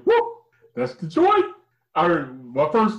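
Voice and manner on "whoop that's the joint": excited whoop. Real, not acted. Excited whoop about song.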